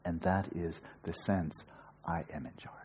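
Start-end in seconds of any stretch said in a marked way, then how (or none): 2.0-2.8 a soft unvoiced whisper of the self's claim; whispered inner voice. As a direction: breathe it like a secret inner thought. Inner voice of the self acted.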